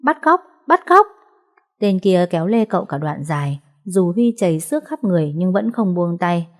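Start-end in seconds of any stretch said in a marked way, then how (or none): 0.0-1.1 high, loud alarm cry; yelling kidnap. A narrator's reading. Injured boy yells high and loud for help. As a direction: scream the alarm to passers-by. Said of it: "miệng không ngừng la hét".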